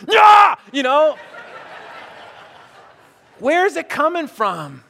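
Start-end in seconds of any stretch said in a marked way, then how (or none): 0.1-0.6 high, very loud roar; sudden burst of mock fury; frustrated yell. Pastor losing his temper on the golf course, yelling.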